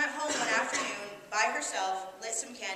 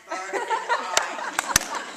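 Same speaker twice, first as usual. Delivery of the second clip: high, loud, drawn-out swoon; swooning excitement; 12 semitones higher, 8 dB louder, much slower than usual. Acted. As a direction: stretch it out, carried away by the dream. Savouring her dream of Burt Reynolds.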